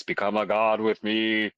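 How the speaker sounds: metallic robot voice. Acted. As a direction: intone it like a machine god. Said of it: "trying to scream in the robot voice".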